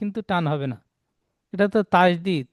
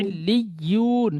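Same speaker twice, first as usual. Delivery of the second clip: Quran recitation; much slower than usual. Shown as a demonstration. Teacher corrects the student.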